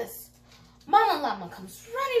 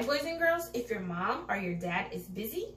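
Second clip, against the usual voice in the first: ordinary delivery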